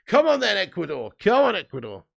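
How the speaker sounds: loud, high rallying cheer. Real, not acted. Fan cheering Ecuador on during the match, loud. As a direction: urge the team on with a shout.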